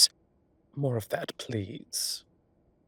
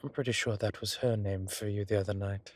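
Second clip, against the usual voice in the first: ordinary delivery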